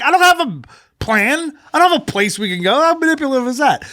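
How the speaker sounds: high, whiny mock protest; exasperated protest. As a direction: squeal the helpless objection. Arguing with wife, exasperated.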